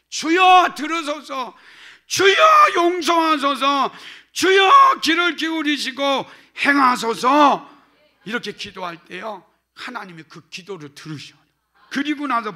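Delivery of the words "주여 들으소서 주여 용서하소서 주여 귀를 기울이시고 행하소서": each Lord shouted high and loud; triple cry of Lord. Shown as a demonstration. Pastor demonstrates the threefold cry of Lord, shouting. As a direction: cry out three times to God. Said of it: "힘들 때 이러세요".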